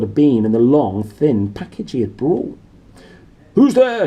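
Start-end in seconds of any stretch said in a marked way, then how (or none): 3.6-4.1 high loud shout at the door. Acted. Uncle Vernon with a rifle, shouting at the door. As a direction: bark it out in alarm. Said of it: "he shouted".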